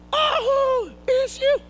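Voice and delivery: very high, tiny voice of the ball; squeaky ball voice. Acted. Ball calls out to him.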